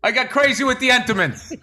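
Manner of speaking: ordinary delivery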